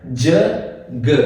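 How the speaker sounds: "ज" then "ग" as short isolated sounds; contrasting sounds. Shown as a demonstration. Teacher contrasting the two sounds of G. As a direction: set "ज" against "ग", each short. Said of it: "जी की आवाज देता है".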